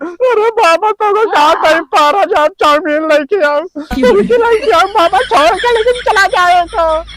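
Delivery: high-pitched mock-desperate begging; desperate pleading. Fake exorcist bargaining with the spirit, high pleading.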